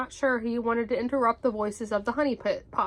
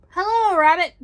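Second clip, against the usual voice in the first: loud, high boisterous greeting; Tigger; 7 semitones higher, 8 dB louder than usual. A narrator's reading. Tigger greets Rabbit he bumped into, exuberant. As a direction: belt out a bouncy hello.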